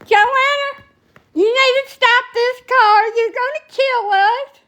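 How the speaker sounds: put-on high, pleading old woman's voice; panicked grandma. Grandma begging Joanna to stop the car, imitated panic. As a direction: beg the driver to stop the car.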